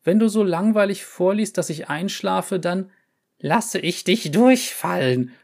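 high, slowing on the threat; threatening professor. A narrator's reading. Cat threatens student.

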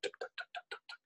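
soft, voiceless rapid puffs; imitated bizarre breathing. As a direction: imitate a chest pumping in quick little ticks. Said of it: "his breathing was just really this bizarre thing".

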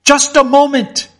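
high, loud appeal to the storm; panicked cry for a pause; frantic plea. Acted. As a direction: shout up at the thunder to wait. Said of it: "said Mister Fotheringay to the lightning and thunder".

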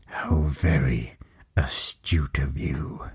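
icy low whisper, slow; cold voice. Acted. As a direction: whisper it coldly and slowly. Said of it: "at the cold voice".

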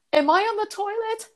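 very high-pitched anxious question, acted out; freaking out. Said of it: "I start freaking out".

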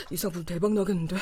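normal talking voice

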